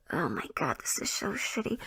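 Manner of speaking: very soft, sighed aside; dismayed whisper. Real, not acted. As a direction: sigh and whisper it to yourself. Storyteller breaks off in dismay.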